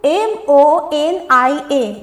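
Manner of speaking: very slow letter-by-letter reading; spelling out. Shown as a demonstration. Teacher reading the third word's spelling, spelling out. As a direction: spell it out, drawing out each letter. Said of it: "आइए spelling पढ़ते हैं".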